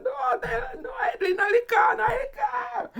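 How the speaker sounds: high, tearful pleading yell; crying shout. Retold or imitated. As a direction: beg him to stop, half sobbing. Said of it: "Del Sada saying".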